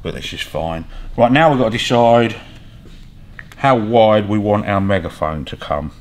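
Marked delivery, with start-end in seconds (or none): none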